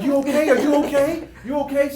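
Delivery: high, loud worried calls; alarmed checking. Retold or imitated. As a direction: call through the door in a panic. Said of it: "I run to the bathroom like".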